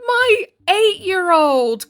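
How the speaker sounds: high, loud words breaking into laughter; cracking up mid-rant; laughing. Real, not acted. Mocking the whiny boyfriend while laughing out loud.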